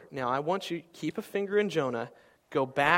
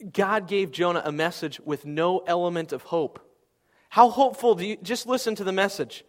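raised, pressing sermon voice; full-force preaching; 4 semitones higher, 5 dB louder than usual. Acted. Pastor driving the point home to his congregation, full-force preaching. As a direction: preach it hard to the room.

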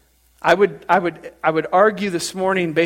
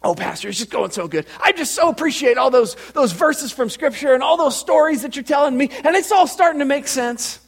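Teresa impression; 8 semitones higher than usual. Parishioner telling pastor how study's going, gushing high impression. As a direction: gush to the pastor in a raised voice. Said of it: "That's exactly how she talks".

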